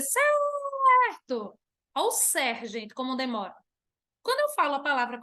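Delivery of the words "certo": high, long-drawn "cer" call; calling after someone. Shown as a demonstration. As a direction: call out to a friend walking away. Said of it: "ele tá indo embora com o meu trabalho, eu vou dizer".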